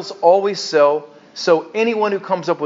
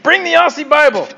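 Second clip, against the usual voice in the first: higher-pitched, hyped-up imitation of an eager listener; mock eager demand; 6 semitones higher than usual. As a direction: play a fan egging the preacher on. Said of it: "He's like".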